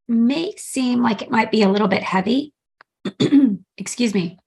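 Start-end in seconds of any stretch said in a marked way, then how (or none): 3.0-3.6 a quick throat clear. Real, not acted. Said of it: "I've got a tickle in my throat".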